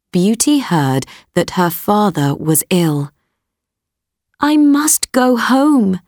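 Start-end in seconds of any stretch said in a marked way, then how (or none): 4.4-6.0 put-on high, earnest girl's voice; a daughter pleading, pitched high; young girl voice. A narrator's reading. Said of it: "she said to the beast".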